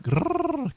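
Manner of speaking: high rolling purr, grrr; rolled R purr. Shown as a demonstration. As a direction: roll the R hard and high like a purr. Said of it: "You gotta roll your R's like this".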